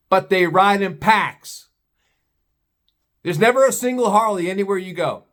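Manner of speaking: ordinary delivery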